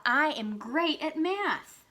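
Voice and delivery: high, stretched-out proud cheer; excited exclamation. Shown as a demonstration. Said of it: "saying it with excitement in my voice".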